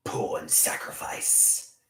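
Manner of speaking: low, slow whispered title. Acted. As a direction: hush it like a secret. Low unvoiced repeated joke.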